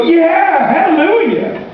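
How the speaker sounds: high cheering hallelujah; the people cheering Jesus, high and excited; excited crowd. Acted. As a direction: cheer it like a thrilled congregation. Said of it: "And the people go".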